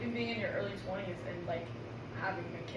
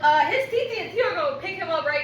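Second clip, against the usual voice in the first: loud, high defiant retort; mock sassy mom; 9 semitones higher, 13 dB louder than usual. Aunt voicing mom answering school, loud and high. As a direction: snap back at the school. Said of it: "You said".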